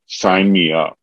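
ordinary talk, nothing marked

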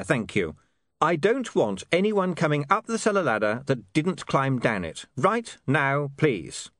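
high-pitched, indignant orders; exasperated young man giving orders; William, stern. Acted. William orders dwarfs after glaring.

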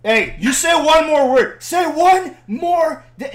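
high, loud shouted warning; re-enacted furious threat, cut off; angry challenge. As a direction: dare them at full voice. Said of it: "I'm like".

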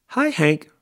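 high, loud cheery hello; calling out. A narrator's reading. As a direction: call out a greeting, high and lively. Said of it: "Rosie the Tumbleweed called out".

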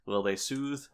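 usual speaking voice

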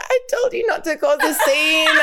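high, wailing imitation of a crying friend; mock crying. Crying friend in the corner reproaching Riley, mock crying. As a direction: blubber it high, half laughing. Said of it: "crying in the corner".

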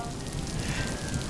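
long, soft, unvoiced sigh; weary sigh. Real, not acted. Narrator resigned to the rain, a tired sigh. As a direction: let the air out in defeat.

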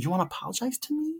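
high, quick, wondering question; excited child's voice. Retold or imitated. Nephew surprised by aunt's apology, excited and high. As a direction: pitch it up like an eager little boy. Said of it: "he still was so excited".

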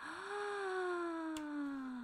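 quiet startled in-breath gasp; soft gasp. A narrator's reading. Narrator gasps as the rival appears. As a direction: gasp softly in suspense.

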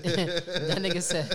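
slow words through laughter; laughing. Real, not acted. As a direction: laugh while you say it. Cracking up retelling a line.

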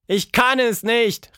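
high, loud, drawn-out despair; desperate cry. A narrator's reading. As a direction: wail it slowly, about to give up. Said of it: "rief sie verzweifelt". (verzweifelt gerufen)

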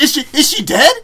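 high, loud hammed-up disbelief; shocked yell. Ordell retold, shocked.

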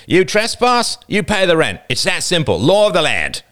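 high, loud laying down of rules. A narrator's reading. Older boy demanding rent from trespassers, loudly. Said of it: "said the older boy loudly".

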